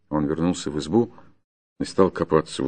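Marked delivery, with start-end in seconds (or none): none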